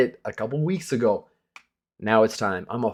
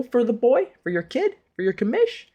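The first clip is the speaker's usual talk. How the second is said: playful rise; 13 semitones higher than usual. Real, not acted. Playful self-reference, rising.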